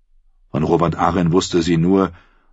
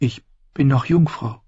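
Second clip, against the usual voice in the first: put-on higher, halting young voice; timid girl; 9 semitones higher than usual. A narrator's reading. Sansa blushing as she answers her aunt, hesitant. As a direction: stammer it out, embarrassed.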